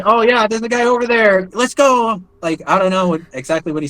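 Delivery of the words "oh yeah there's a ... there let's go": high, excited imitation of a rattled soldier; mock high-pitched urgency, half a scream; frantic soldier. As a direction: mimic the jumpy guy eager to charge in. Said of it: "he did, like, a little scream".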